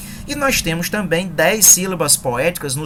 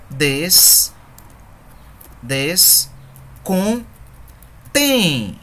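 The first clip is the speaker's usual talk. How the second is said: syllables split and drawn out with long pauses; scanning the verse; 2 semitones higher, much slower than usual. Shown as a demonstration. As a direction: break the line into syllables, slowly. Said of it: "Vamos treinar, treinar no terceiro verso". (escansão silabada e lenta)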